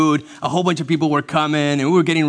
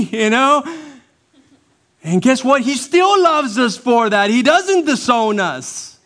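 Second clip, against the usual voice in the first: high, loud pulpit delivery; impassioned preaching; 12 semitones higher, 6 dB louder than usual. Acted. Pastor telling how God still loves us, impassioned preaching. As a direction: preach it with raised pitch and volume.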